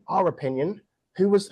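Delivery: ordinary talk, nothing marked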